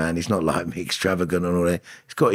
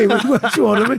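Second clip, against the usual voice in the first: higher and louder, delighted; thrilled repeat, pitched way up; excited; 6 dB louder than usual. Real, not acted. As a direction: say it again with gleeful disbelief. Excited repeat after laughing.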